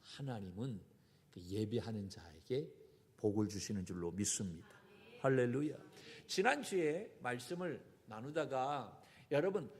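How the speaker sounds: ordinary delivery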